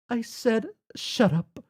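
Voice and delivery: angry player character silencing a chatterbox; snapping. Acted. Jane snapping at Jake.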